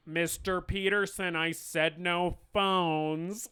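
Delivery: slow, stern detention-teacher send-up; strict eighties teacher. Retold or imitated. Detention teacher telling off a student, mock strictness. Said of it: "Bueller ass teacher".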